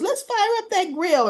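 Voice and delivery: high, perky imitation of a girl; eager cheer. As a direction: pipe up with mock eagerness. Retold eager girl at cookout.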